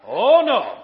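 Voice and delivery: loud, comic oh-no; emphatic refusal. Acted. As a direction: belt it out as a mock-dramatic refusal. Acts Nehemiah's emphatic refusal.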